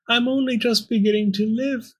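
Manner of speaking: high, plaintive little voice; whispering Pearl. A narrator's reading. Captive Pearl confiding in the bone, plaintive mock whisper. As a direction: lament in a high voice, not truly whispered. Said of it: "Pearl whispered back".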